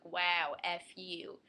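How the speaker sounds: loud wow then flat curse; mock outrage. Teen whose song got cut, mock outraged wow. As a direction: burst out then drop it flat. Angry when radio turned off.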